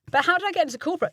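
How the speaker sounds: usual speaking voice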